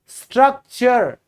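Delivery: स्ट्रक्चर said slowly as struk-cher; slow teacher demo of स्ट्रक्चर; slow model word. Shown as a demonstration. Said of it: "दो बार बोलूंगा".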